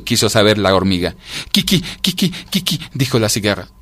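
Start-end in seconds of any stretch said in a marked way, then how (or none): 1.5-2.8 high, squeaked syllables; stuck stammering on one sound; cold creature's pitched-up stutter; shivering stutter. A narrator's reading. Cicada stutters like a rooster.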